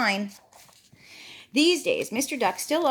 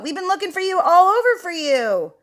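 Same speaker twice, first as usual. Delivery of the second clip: high, loud, sweeping call; excited hailing; 9 semitones higher, 6 dB louder than usual. Acted. Goat has found Mister Duck, high excited call. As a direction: call out high, sliding down at the end.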